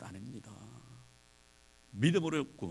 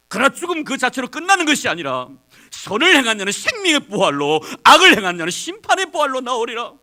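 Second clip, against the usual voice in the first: much higher, much louder sermon; fiery preacher warning of heaven and hell; full-force preaching; 9 semitones higher, 16 dB louder than usual. Acted. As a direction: thunder it from the pulpit.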